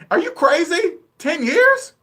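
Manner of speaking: high, loud disbelief; incredulous. Real, not acted. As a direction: shoot the pitch up in shock. Incredulous reaction to loan plan.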